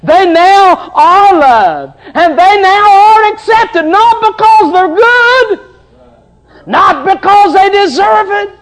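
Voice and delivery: loud, high revival preaching; preaching at full force. Acted. Preacher thundering the Joseph story to his church, loud and high. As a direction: preach it out to the congregation.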